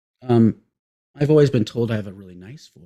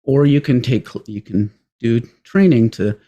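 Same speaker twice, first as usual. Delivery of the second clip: ordinary talk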